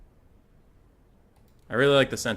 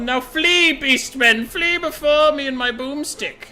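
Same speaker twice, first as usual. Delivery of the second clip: high, loud rousing battle-captain bellow; rally the cannon crews like a zealous officer; bombastic commander; 10 dB louder, slower than usual. Acted. Game commander rallying his cannon crews, booming and rousing.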